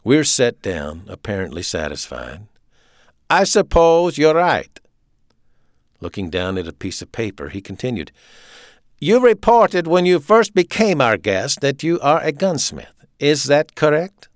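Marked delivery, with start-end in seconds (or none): 3.3-4.8 slow, high, loud German officer; staccato German accent. A narrator's reading. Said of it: "he spoke with a staccato German accent". 9.0-14.3 loud, high German officer; authoritarian, punched-out German accent; staccato German accent. A narrator's reading. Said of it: "he spoke with a staccato German accent".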